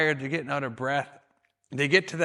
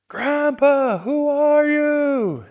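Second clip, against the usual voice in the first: high, loud call, slowing right down on the question; yelling out; 12 semitones higher, 10 dB louder, much slower than usual. Retold or imitated. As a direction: call out across the field to the old man. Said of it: "he yells that out".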